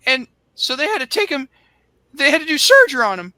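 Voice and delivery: high, squeaky Cornette voice; Cornette impression. Bruce's friend on the phone, in a high squeaky whine. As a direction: squeal it out like a frantic southern manager. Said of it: "Cornette's going like".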